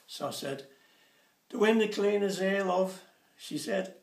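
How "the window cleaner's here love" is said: louder, high call to the wife upstairs; calling up to his wife in the shower, loud. Retold or imitated. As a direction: shout up the stairs.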